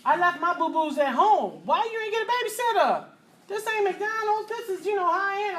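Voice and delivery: high, indignant complaining; a haughty patron scolding the parents; snooty diner. Retold or imitated. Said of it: "Were you just like".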